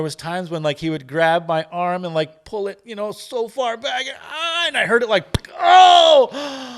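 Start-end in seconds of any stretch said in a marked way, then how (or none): 5.6-6.7 sudden high, loud yelp of surprise; startled oh. Retold or imitated. Retelling the massage therapist yanking his arm back, a high startled yelp. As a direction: blurt it as the joint pops.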